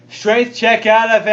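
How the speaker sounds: out-loud repeat. Real, not acted. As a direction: say it up for the whole table. Repeats hushed aside out loud.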